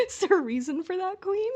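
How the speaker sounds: quick, high, sing-song tease; playful teasing. Real, not acted. Teasing co-host over her reaction, quick and high. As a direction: needle her playfully, pitch way up.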